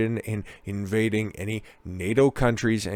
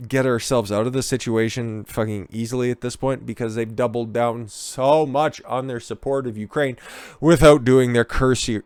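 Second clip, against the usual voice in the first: ordinary talk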